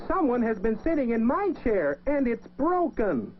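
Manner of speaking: wee little voice. A narrator's reading. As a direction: squeak it out like a small bear cub, sad it's broken. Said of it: "Baby Bear said in his wee little voice".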